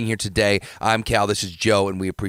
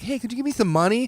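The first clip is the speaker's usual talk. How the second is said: mock high begging voice; 13 semitones higher than usual. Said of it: "be like".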